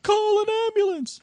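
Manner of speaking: high-pitched, drawn-out cry of horror; horrified. Retold or imitated. Dennis looking at the crash victim in horror, horrified. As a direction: imitate a shocked man's panicked, stretched-out call for help. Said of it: "looking at him in horror".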